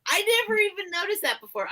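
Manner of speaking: high, quick, amazed; a high surprised rush. Real, not acted. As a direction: blurt it out in surprise. Surprised at her own toy.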